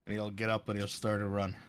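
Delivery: ordinary talk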